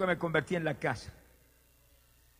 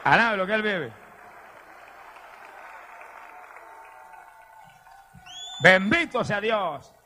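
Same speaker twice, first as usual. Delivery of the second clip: exclaimed praise; 4 semitones higher, slower than usual. Real, not acted. Preacher urging the congregation to praise God, exultant shout. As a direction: call it out big, stretching the blessing.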